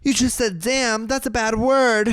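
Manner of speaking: high, drawn-out pretend horror; mock scandalized. Teasing the man for his own swearing, mock scandalized.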